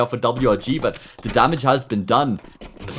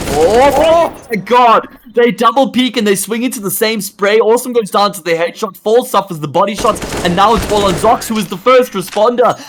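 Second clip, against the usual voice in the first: shouted, high, excited play-by-play; explosive hype call; 8 semitones higher, 5 dB louder than usual. Real, not acted. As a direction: erupt on the big play. Caster erupts at a big play.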